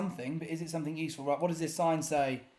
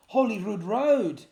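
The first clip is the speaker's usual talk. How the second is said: slow, loud, higher Holyrood Road; real thrilled recognition; excited recognition; 5 semitones higher, 8 dB louder than usual. Real, not acted. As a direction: it suddenly clicks. Recognises the place she walked.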